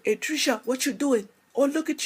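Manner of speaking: high, loud West Indian auntie calling out; West Indian relatives' greeting. Retold or imitated. West Indian relatives greeting Trisha when she arrives, high excited accent. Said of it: "there's a lot of talk like this".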